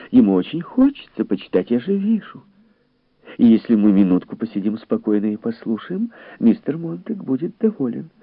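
high, lively female voice; high-voiced sociable coaxing; placating lady guest. A narrator's reading.

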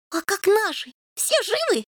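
high, anxious kitten questions; eager kitten voice. A narrator's reading. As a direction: press for news like a worried kitten. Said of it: "допытывался Рыжий".

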